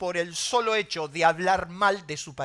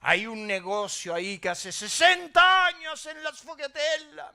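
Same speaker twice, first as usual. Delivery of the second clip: enthusiastic driver; 2 semitones higher, 3 dB louder, slower than usual. Retold or imitated. Driver recommending a pastry shop, enthusiastic imitation. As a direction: open on a loud "ah", then rave in a raised pitch. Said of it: "Y me dice".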